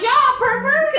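high-pitched mock exclamation; mock outburst. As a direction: squeal it out, imitating her reaction. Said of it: "Look at her, she's like".

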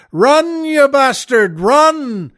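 high, loud yell of run; scream. Retold or imitated. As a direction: scream it from the stands. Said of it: "the Scotsman stands up and screams".

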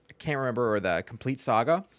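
usual speaking voice

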